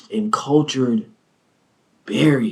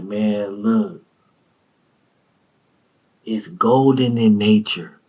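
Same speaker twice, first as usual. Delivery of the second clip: lightly voiced connoisseur friend; 5 semitones lower, slower than usual. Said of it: "He was like".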